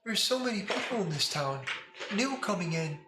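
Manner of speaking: put-on high little girl voice, worried; a small child fretting about danger; child form. Child Lila complaining to the player about the town, high voice. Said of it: "in child form".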